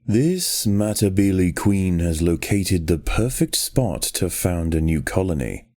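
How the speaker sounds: ordinary talk, nothing marked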